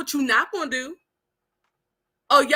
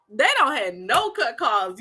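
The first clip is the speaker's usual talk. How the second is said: usual speaking voice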